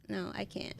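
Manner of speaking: ordinary talk, nothing marked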